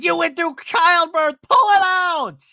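high, loud screeched order; hammed-up screaming pep talk; mock exasperated yell. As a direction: imitate a reality star shouting someone down. Acting Vicky yelling at Gina.

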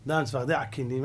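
normal talking voice

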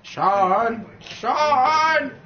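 hammed-up father calling a lost child; mock calling out. Parodies father calling for son.

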